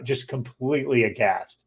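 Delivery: ordinary delivery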